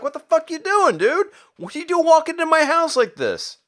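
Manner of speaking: very high, squeaky mock outrage; indignant protest. Peter to uninvited Miles at his window, high-pitched mock indignation. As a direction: shoot the pitch way up, affronted. Peter voiced startled by the intruder.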